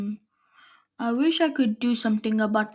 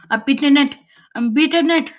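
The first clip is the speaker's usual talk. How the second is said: louder, rising cry of victory; triumphant gloating; 4 semitones higher, 6 dB louder than usual. Real, not acted. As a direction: crow over your win. Gloating over beating the water.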